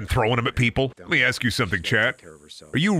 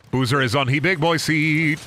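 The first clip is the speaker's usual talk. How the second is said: ordinary talk, nothing marked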